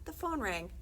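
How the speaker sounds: plain reading voice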